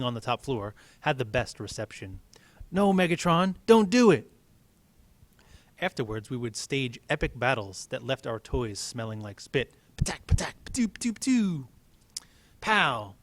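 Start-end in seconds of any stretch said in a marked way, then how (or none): 2.7-4.3 high, loud cry at the TV; excited kid shout. Retold or imitated. Kids watching cartoon, shouting. 10.0-11.7 high, breathy spit-flying sound effects; spray imitated gunfire like a kid; spitty battle noises.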